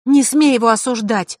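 high, loud, angry retort; snapping back. A narrator's reading. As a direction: snap fiercely in someone's defence. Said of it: "огрызнулась Василиса".

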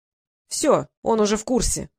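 pleased. A narrator's reading. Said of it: "довольно сообщил Норт".

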